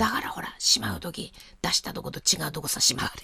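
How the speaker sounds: ひそひそと打ち明ける小声; 息まじりに声を落として内緒話のように. Real, not acted. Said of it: "今、小声になってますけども". (声をひそめた内緒話)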